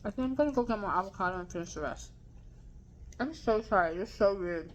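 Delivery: talking while chewing; words round a mouthful of food; mouth full. Real, not acted. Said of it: "I'm talking with my mouth full".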